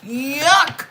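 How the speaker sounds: playful disgust at the ex; disgusted ew yuck. Retold or imitated. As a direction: squeal ew, then spit yuck. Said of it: "you gonna be over there like".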